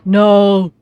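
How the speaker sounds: loud anguished "No!"; anguished cry. Acted. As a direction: cry out in horror. Character hears of death.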